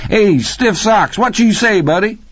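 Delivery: ecstatic, high-pitched hail; overjoyed yell. A narrator's reading. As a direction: holler to a long-lost buddy. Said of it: "his delight nearly orgasmic".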